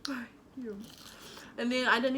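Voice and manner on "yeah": whisper. Real, not acted. Whispered reply.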